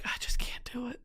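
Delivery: hushed whisper of defeat. Retold or imitated. Mocking her own defeat, hushed whisper. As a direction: breathe it out, barely voiced. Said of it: "And I was like".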